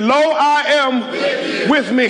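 "Lo, I am with me" slow, high and sure; said like you believe it. Shown as a demonstration. Pastor shows the congregation how to say it, slow and sure. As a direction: declare it with total conviction. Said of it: "I'll say it like you believe it".

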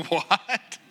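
baffled, questioning "what?"; acted-out bewilderment, rising at the end; mock confusion. Baffled reply to Russian speaker.

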